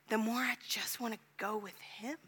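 whispered, intimate confession. Real, not acted. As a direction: breathe it out softly. Personal confession in sermon.

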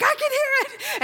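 as if hearing for the first time; healed woman testing her hearing, high and thrilled; thrilled discovery. Retold or imitated. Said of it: "And she's like".

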